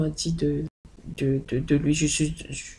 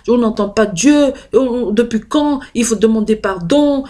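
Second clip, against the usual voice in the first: higher, louder mother's scolding; imitated scolding mother; 5 semitones higher, 6 dB louder than usual. Mother forbidding her to tell the sick grandmother, higher and louder.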